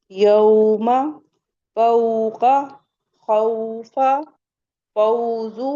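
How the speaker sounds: "यौमा फौका खौफा" with au gliding into u, each word lifted at the end; correct leen reading. Shown as a demonstration. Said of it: "देखिए कैसे पढ़ेंगे".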